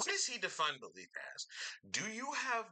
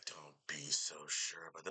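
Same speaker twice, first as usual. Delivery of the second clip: mock ominous villain; 10 semitones lower, 3 dB quieter than usual. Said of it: "He's like".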